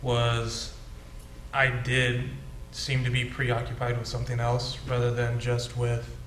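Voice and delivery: ordinary talk, nothing marked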